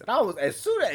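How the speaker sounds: usual speaking voice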